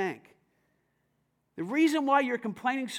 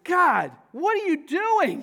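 mock complaint to God; 8 semitones higher, 8 dB louder, slower than usual. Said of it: "They went".

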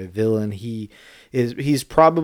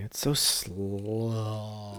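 mock slow; 12 dB quieter, much slower than usual. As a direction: drag it out very slowly to show how slow it is. Complains the Godfather is slow.

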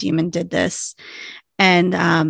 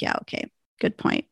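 much lower and softer, a deflated yeah okay; resigned quote; 10 dB quieter than usual. Retold or imitated. Hot giving in to Doc, quietly deflated. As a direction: concede it under your breath. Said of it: "she's like".

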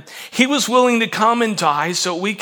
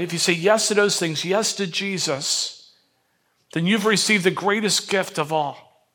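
ordinary talk, nothing marked

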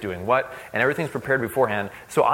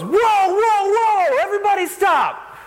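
stop shout; 6 dB louder, slower than usual. Retold or imitated. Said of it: "just goes".

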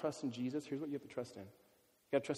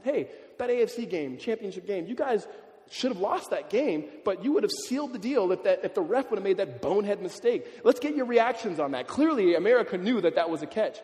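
hyped interviewer; 5 semitones higher, 7 dB louder than usual. Retold or imitated. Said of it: "they said".

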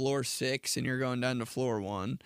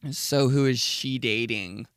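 gossipy brunch voice; 3 dB louder, slower than usual. Retold or imitated. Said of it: "wanna hear gossip".

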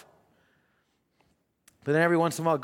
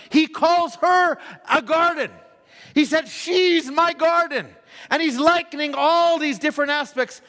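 rising preacher's build, stretched words; preaching; 5 semitones higher than usual. Acted. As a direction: paint the scene from the pulpit.

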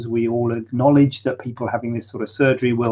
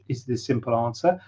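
ordinary talk

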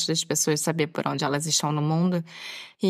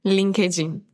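Brazilian-style English; slower than usual. Shown as a demonstration. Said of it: "como os brasileiros falam".

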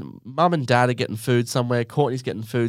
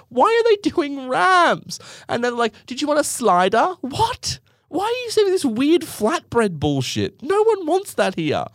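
much higher, disbelieving outburst; incredulous rant. Real, not acted. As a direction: rant in mock outrage about the wraps. Ranting about KFC wraps.

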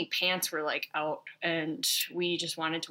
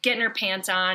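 normal talking voice

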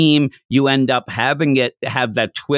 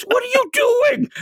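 outraged shout. Retold or imitated. Oolong shrieking at Goku for patting him, outraged shout. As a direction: yell it as if someone just grabbed you.

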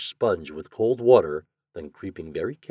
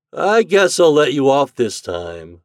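loud, slow, raised-pitch concession; relenting; 6 semitones higher, 10 dB louder than usual. A narrator's reading. Chuck releasing Toad from the bed, relenting. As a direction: give in, drawing the words out. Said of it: "relented Chuck".

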